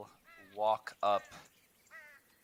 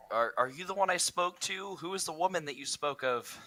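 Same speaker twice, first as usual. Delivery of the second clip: high, raised questioning call; Rain calling out; 10 semitones higher, 4 dB louder than usual. Retold or imitated. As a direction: call out to the figure. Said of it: "Rain would shout out".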